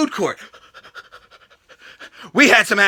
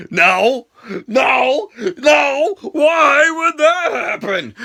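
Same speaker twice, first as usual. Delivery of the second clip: mock crying; 5 semitones higher, much slower than usual. Mock despair over the title result.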